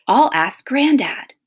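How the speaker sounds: bright with a new plan; excited idea. Acted.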